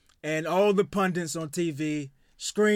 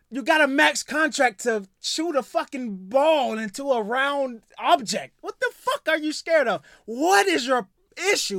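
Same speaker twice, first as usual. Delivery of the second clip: loud, higher exasperated rant; worked-up rant; 7 semitones higher, 4 dB louder than usual. Real, not acted. Ranting at Ben Simmons, loud and exasperated. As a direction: lay into him, fed up and loud. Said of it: "get worked up".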